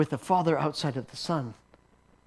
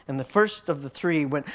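ordinary talk, nothing marked

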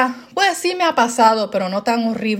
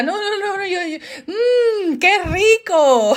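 mock whimper turning to forced relish; high child voice, ending in a drawn-out fake yum; tears suddenly gone; 8 semitones higher, slower than usual. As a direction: switch from crying kid to exaggerated delight. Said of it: "se me quitaban las lágrimas".

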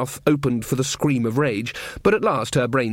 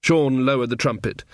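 ordinary talk

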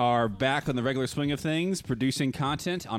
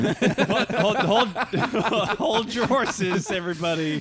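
laughing; 7 semitones higher, 5 dB louder, much slower than usual. Acted. Laughing mock halt to group.

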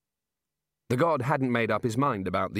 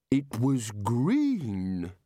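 very slow, dreamy drawl; addled bursar; much slower than usual. Acted. Muddled bursar interrupting the Dean, slow and dreamy. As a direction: stretch each word out vacantly.

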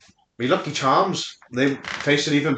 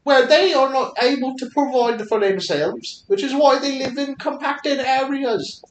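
mock Chinese accent; 7 semitones higher than usual. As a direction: lecture in a hammed-up Chinese accent. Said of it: "we gotta go back to the accents".

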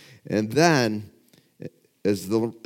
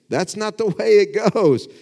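much higher, louder cry of protest; the baffled crowd protesting the countdown; outraged protest; 11 semitones higher, 7 dB louder than usual. Retold or imitated. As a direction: shout it as an indignant onlooker.

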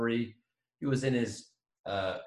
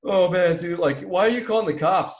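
a hint of a whiny dude, louder and higher; whiny young driver; 6 semitones higher, 5 dB louder than usual. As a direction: plead like a spoiled kid caught out.

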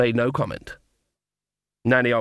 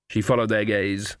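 ordinary delivery

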